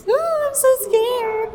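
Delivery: high, slow playful fright; mock scared. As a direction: pretend to be spooked. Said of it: "Wait, why am I scared?".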